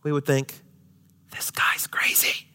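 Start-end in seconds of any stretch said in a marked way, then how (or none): 1.3-2.5 hushed unvoiced whisper. Whispering what the friends thought. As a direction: breathe it like a secret.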